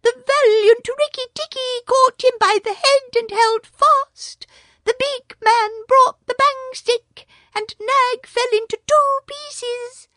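a small proud bird boasting; Darzee the tailorbird. A narrator's reading.

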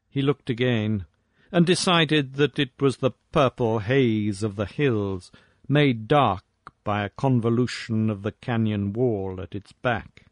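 ordinary delivery, nothing marked

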